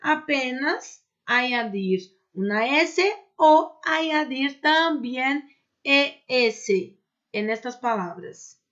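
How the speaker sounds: usual speaking voice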